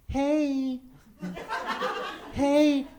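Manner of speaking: put-on high, drawn-out American girl voice; badly faked American accent, pitched high; butchered American accent. American girl approaching her in a club, bad American accent. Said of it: "I'm about to butcher your accent".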